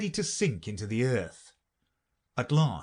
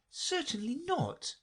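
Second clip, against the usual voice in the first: put-on higher, slow offended girl; indignant Alice; 5 dB quieter than usual. Alice answering the Mock Turtle, indignant. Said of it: "said Alice indignantly".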